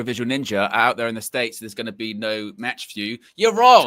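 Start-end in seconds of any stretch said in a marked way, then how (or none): 3.4-3.9 much louder and higher, punched out; emphatic rebuttal. Pretend. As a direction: throw it out loudly at the doubters. Emphatic rebuttal to imagined doubters.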